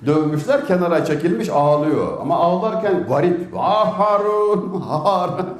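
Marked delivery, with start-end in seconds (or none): 3.6-5.5 slow, high mock lament for Harun; lightly imitated weeping call; wailing Harun's name. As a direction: draw the name out like a sobbing plea. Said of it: "diye ağlıyor".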